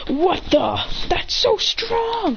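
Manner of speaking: stunned by the opponent's power; surprised. Acted. Hit stunned by the strength, high slow surprise. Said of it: "He says".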